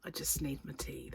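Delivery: whispered aside; whispering. Real, not acted. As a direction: drop to a whisper for the punchline.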